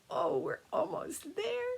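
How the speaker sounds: put-on high, slow, soft little-kid voice; relieved children. Children seeing Dragon Island at last, high soft relieved voice. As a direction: breathe it out like tired kids spotting land.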